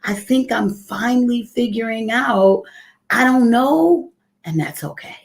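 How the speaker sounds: tearful crying. Real, not acted. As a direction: speak while crying with gratitude. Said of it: "the reason that I'm crying".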